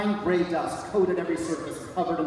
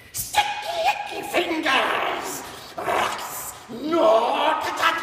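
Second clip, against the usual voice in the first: put-on high, screeching old creature cries; shrieking creature; 13 semitones higher, 4 dB louder, much slower than usual. Creature screeching at the kids who touched his trinkets. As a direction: screech it like a crazed skeletal thing.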